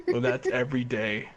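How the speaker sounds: normal talking voice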